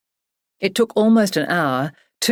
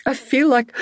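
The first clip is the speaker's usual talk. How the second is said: higher, tearful; breaking down; 9 semitones higher, 3 dB louder than usual. Acted. Barbara about to sob, tearful. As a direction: voice starting to crack. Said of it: "erupting into a new, stronger bout of sobbing".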